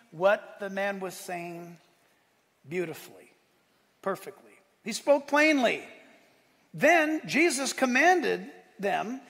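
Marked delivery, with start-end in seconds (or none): 4.8-9.2 loud, slowed, hammering preacher's build; preaching climax. Real, not acted. Preacher thunders the healing story to the congregation, full-force. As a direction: drive it home to the congregation.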